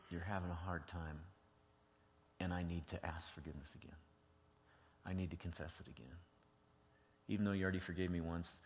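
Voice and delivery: soft, low confession; humble apology. Acted. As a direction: gently ask forgiveness, voice dropping away. Models a humble apology to spouse.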